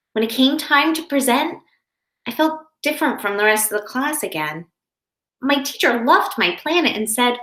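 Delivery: put-on lively storybook narration for kids; reading to children. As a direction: read it aloud like to a classroom. Reads picture book to class.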